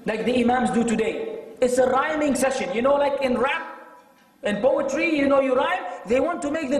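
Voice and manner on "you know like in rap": ordinary talk, nothing marked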